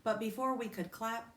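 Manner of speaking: ordinary delivery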